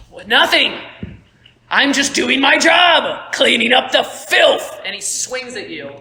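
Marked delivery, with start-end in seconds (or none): 0.1-4.8 loud, higher, slow defiant shout; self-righteous snarl. Acted. Merrick justifying himself to Stonebreaker, loud and defiant. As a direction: hurl it back with cold contempt. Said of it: "It's like".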